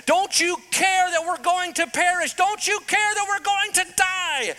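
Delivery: high, loud, desperate cry; panicked plea. Retold or imitated. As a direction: yell over the storm in panic. Disciples crying out in storm.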